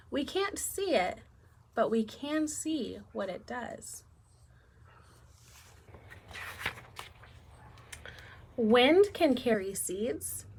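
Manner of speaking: slow, clear storybook reading; reading to children. Acted. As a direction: read it slowly to little kids. Reads picture book to class.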